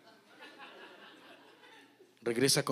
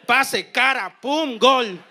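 higher, louder commentator-style goal call; mock goal call; 8 semitones higher, 10 dB louder, slower than usual. Comparing the lucky crack to a Chicharito goal, hyped.

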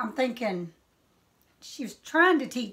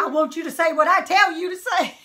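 loud, raised-pitch scolding; retold stern mother; 5 semitones higher, 6 dB louder than usual. Mama to child mid-whipping, loud stern scolding. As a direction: lay down the law like a mother.

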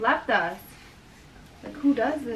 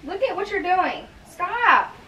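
sharp scold; 7 semitones higher, 4 dB louder than usual. Real, not acted. Mom scolding the child.

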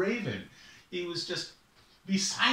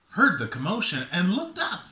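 ordinary delivery

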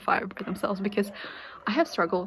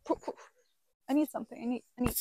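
usual speaking voice

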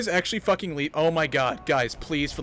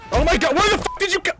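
high, loud and fast; frantic, rushed yell; alarmed shout; 10 semitones higher, 9 dB louder, faster than usual. Real, not acted. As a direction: blurt it out in a panic. Loses someone in the game.

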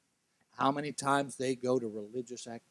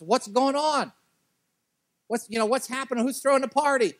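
much higher and louder, baffled; puzzled shout; 12 semitones higher, 9 dB louder than usual. Acted. Older son demanding answers from a servant, loud and baffled. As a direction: call out, confused by the music.

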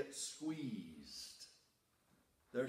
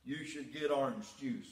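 normal talking voice

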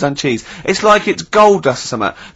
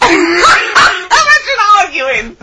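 laughing; 14 semitones higher, much slower than usual. Real, not acted. Laughing at argument idea.

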